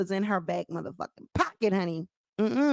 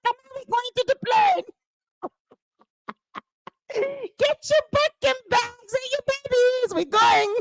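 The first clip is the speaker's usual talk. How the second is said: high, breezy mock voice; 11 semitones higher than usual. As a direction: toss it off high and carefree, heading to the plane. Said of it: "She like".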